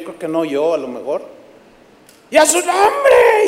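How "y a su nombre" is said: very high, slow, loud cry of praise; an elderly lady bursting out in church; old sister shouting. Retold or imitated. An old sister in the pews shouting out very high and loud. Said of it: "una hermanita ya ancianita".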